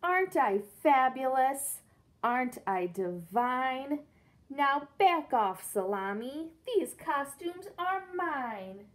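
loud, drawn-out vain pug; diva brag. Acted. Pig brags to Trevor about his costumes, loud vain diva. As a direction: preen then shove him off.

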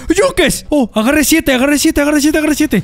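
very high, excited repeating; thrilled. Real, not acted. Player grabbing seven anvils, thrilled. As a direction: squeal the find over and over.

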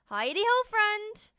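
high, loud bubbly greeting; cheery porcupine. Acted. Pickles greeting Tank on the road, high loud and cheery. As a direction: greet a friend, high and bright.

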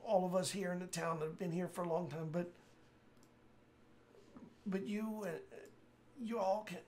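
urgent plea with last breath; dying man voice. Acted. Dying NPC continues.

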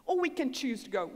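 ordinary delivery, nothing marked

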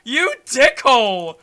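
slow loud insult; angry. Real, not acted. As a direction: spit it out furious. Angry at enemy after hit.

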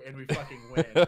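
bursting into a loud laugh on the win; real laugh breaking through the line. Real, not acted. Laughing at the victory.

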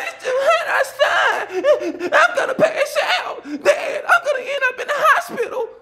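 very high, slow, laughing whiny wail; dad mocking son's whine about heat; mocking crybaby kid. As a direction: mimic a wailing crybaby. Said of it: "I don't care about it".

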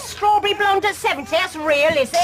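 high-pitched mocking challenge; scornful taunt. Mocking a rival's age in a row, scornful taunt.

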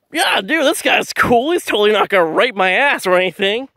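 high-pitched, sarcastic fake trust; mock-naive sarcasm. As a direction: play the gullible fool, dripping with sarcasm. Mocks himself as a gullible victim.

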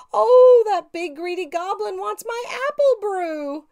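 high, loud wail into a crying plea; frightened cry. A narrator's reading. Witch crying about the greedy goblin. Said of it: "heard a fifth cry".

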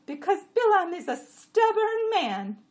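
high, loud, slow donkey voice; complaining jenny. A narrator's reading. Jenny grumbling about the road, high and loud.

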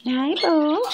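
normal talking voice

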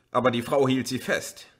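plain reading voice

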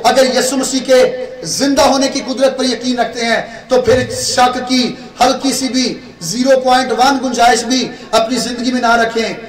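ordinary talk, nothing marked

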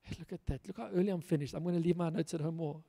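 ordinary delivery

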